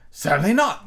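loud, firm refusal; Red Queen refusing firmly; decided. A narrator's reading. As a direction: shut it down flatly. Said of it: "very decidedly".

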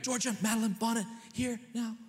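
high, sharp scolding call of the full name; mad full-name call. Retold or imitated. Parent calling daughter over in anger, high sharp scold. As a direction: bark the child's full name like an angry parent. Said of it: "it's to get mad at them".

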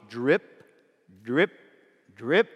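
"drip" as separate short drops, each lifting at the end; low-starting "drip", flicked upward, spaced out; onomatopoeic drip. Shown as a demonstration. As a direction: say "drip" like water dripping, with pauses.

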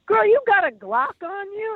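high brassy local woman; sassy woman voice. Retold or imitated. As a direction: ask it like a bold stranger. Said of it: "most interesting woman, like, character".

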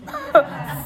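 squealing giggle; real laugh; high laugh. Real, not acted. Laughs after joke.

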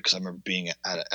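ordinary talk

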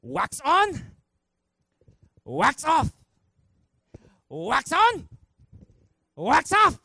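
high, loud karate-drill commands; hammed-up martial arts master shouting drills; Mister Miyagi. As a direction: punch out each order with long pauses.

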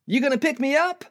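very high, eager buddy voice; high-pitched chummy query; friend's casual question. Acted. Acted friend asking for a ride.

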